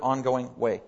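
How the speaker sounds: ordinary talk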